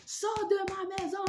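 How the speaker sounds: high, loud order to get out; mock yell. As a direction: chase her out with a shriek. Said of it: "Elle a commencé à crier". (cri aigu imité)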